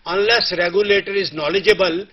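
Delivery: ordinary talk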